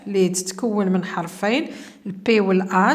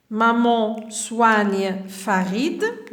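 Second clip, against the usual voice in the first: very slow, word by word; slow reading; much slower than usual. Shown as a demonstration. Teacher reading the first sentence to pupils, very slowly. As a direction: read it out slowly for the class. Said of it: "الجملة الأولى،".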